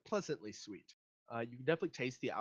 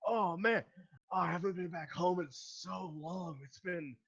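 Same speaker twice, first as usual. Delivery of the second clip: high, loud, excited tipsy dwarf; drunk dwarf, delighted; 7 semitones higher, 4 dB louder than usual. Acted. Drunk dwarf meets a fellow countryman, loudly delighted. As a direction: burst out high, then settle a little. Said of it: "the dwarf is pretty drunk".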